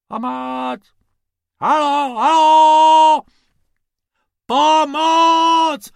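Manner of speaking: high, loud distant cries; calling for help. Acted. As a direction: call out from far away for rescue. Tourists calling for help from desert. (turisté z pouště volají o pomoc, vysoko a hlasitě)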